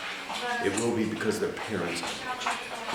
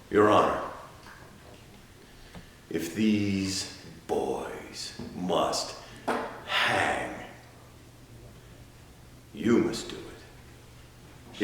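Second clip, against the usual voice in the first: very slow, low and soft; hushed, halting gravity; solemn charge; 6 semitones lower, 7 dB quieter, much slower than usual. Acted. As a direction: weigh each word gravely, leaving long pauses. Solemn charge laid on the judge.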